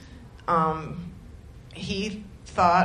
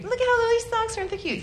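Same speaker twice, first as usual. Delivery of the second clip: put-on much higher squealing girly voice; over-the-top cooing mom-to-be; gushing friend; 12 semitones higher, faster than usual. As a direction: squeal over tiny socks. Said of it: "friend quoted".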